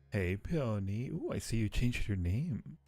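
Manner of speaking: ordinary talk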